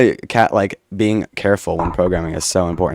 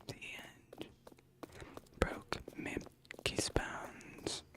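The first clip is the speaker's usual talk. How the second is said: sussurro sem voz, arrastado; whispered eerily, very slow; 26 dB quieter, much slower than usual. Pretend. Whispered rhyme read eerily.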